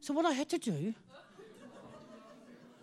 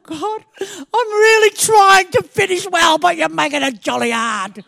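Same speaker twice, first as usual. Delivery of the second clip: loud, slow crying shout to God; tearful cry; 4 semitones higher, 12 dB louder than usual. Retold or imitated. Bursting into tears after the cliff, loud tearful cry. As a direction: sob out the complaint, laughing at the end. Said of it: "I burst into tears".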